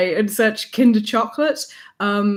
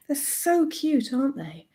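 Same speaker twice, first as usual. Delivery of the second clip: cooing; 5 semitones higher, 5 dB quieter than usual. Real, not acted. Cooing over cute pins.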